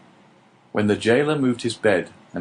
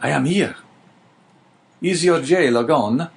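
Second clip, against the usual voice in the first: a hint of an urgent old man, pitched higher; lightly voiced old prisoner; 6 semitones higher, 3 dB louder than usual. The old prisoner checks that the jailer has gone, lightly voiced and urgent. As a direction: ask quickly and carefully. The neighbour answers through the wall.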